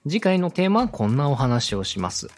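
usual speaking voice

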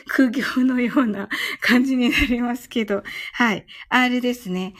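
usual speaking voice

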